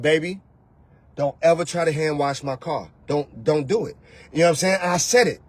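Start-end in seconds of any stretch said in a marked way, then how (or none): 0.0-4.0 calm man. Retold or imitated.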